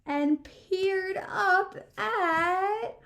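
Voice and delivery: usual speaking voice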